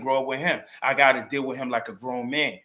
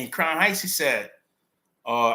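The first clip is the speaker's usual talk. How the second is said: ordinary delivery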